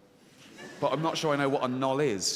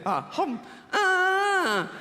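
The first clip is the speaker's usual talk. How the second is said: imitated wail; much slower than usual. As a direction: wail out high and long. Imitating the woman's wail again.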